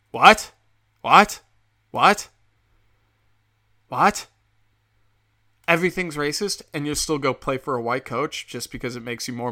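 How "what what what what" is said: loud, rising, disbelieving What?; shouted incredulous what. Real, not acted. Ranting podcaster shouting at Kaepernick's choice, loud outraged disbelief. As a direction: yell What? over and over, each one rising.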